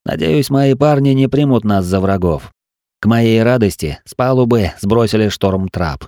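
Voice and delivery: ordinary delivery, nothing marked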